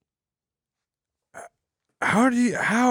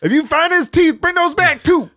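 high, loud squealed call; high mock announcement; 4 dB louder than usual. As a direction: yelp it over the loudspeaker.

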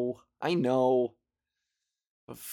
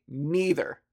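normal talking voice

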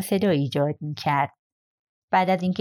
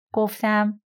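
plain reading voice

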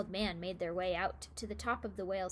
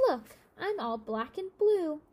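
self-pitying; 6 semitones higher, 6 dB louder than usual. A narrator's reading. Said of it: "feeling very sorry for herself".

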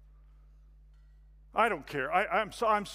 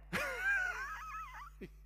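shrill, strained fake laugh; forced manic laugh; 5 dB quieter than usual. Churchgoer laughing off the question, strained and fake. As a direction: laugh way too hard to cover.

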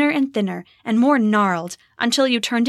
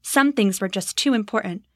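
ordinary delivery

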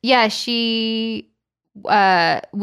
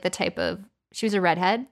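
ordinary talk, nothing marked

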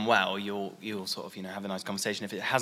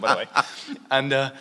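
ordinary delivery

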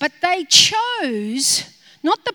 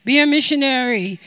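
a lightly voiced, lower booming heavenly voice; imagined voice from heaven, lower and firm; 4 semitones lower, 4 dB louder than usual. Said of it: "this voice to come from heaven saying".

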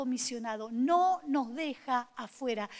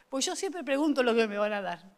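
usual speaking voice

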